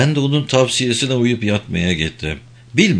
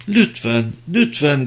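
begging; 5 semitones higher than usual. A narrator's reading. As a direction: gently beg twice. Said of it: "diye yalvardı".

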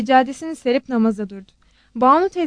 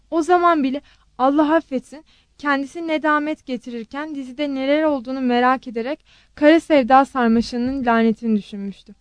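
ordinary delivery, nothing marked

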